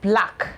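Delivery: careful teacher model. Shown as a demonstration.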